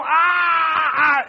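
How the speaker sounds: high-pitched mock scream; drawn-out imitation of raging; acted screaming. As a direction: yell like someone losing their temper. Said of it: "cuando tú estás gritando".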